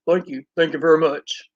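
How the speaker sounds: low rock-and-roll idol impersonation; playful low-voiced celebrity impression. Shown as a demonstration. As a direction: drop the voice into a crooner's thank-you. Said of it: "here's my [name] impression".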